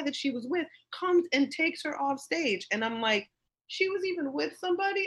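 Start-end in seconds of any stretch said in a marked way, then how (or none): 3.7-5.1 much higher, incredulous question; mock disbelief. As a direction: act stunned by the news. Retold shocked reaction.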